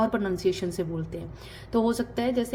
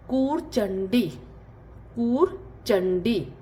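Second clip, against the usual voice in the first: कूरचंडी said slowly twice; slow and clear for learners; slow drill; much slower than usual. Shown as a demonstration. Teacher drills Telugu for sit slowly. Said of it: "सिट".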